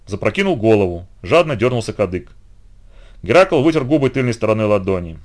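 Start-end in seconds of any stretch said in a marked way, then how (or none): none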